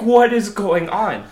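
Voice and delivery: slow, high, laughing disbelief; stunned disbelief. Retold or imitated. Hiker baffled as thousands of bees fly overhead, laughing in disbelief. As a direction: drag it out, half laughing, baffled. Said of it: "And you're like".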